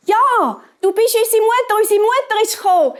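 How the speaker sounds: put-on high, loud, excited kids' cry; high-pitched glee at mother's return; children calling joyfully. A narrator's reading. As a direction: burst out like delighted little ones. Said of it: "haben die Geissenkinder gerufen".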